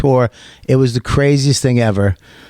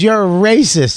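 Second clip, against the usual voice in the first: mock accuser; 8 semitones higher than usual. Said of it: "She goes".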